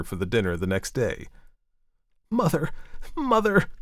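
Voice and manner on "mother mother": high-pitched, urgent appeal to mother; distressed exclamation. A narrator's reading. Upset daughter appeals to her mother, exclaiming. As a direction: cry out for mother, pitched well up. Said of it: "she exclaimed".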